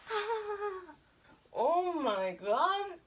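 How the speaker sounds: high, drawn-out mock wail; retold cry of pain; acted pain. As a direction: whimper in hammed-up pain, dragging the words. Acts out pain after fall.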